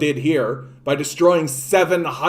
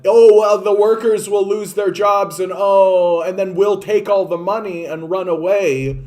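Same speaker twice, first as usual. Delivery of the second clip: high, loud mocking whine; mock the whining excuse makers; mocking whiny excuse; 3 semitones higher, 3 dB louder than usual. Mocking politicians' excuse, loud and high. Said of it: "workers, right? That".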